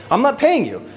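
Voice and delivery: high, insistent mock refusal; defiant refusal. Traveler refusing the border guard's bribe, high and defiant. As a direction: refuse flat out, pitch jumping high. Retelling border standoff argument.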